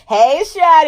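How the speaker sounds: a light impression of a coworker's casual hello. Coworker greeting her at work, high playful hello. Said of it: "She'll be like".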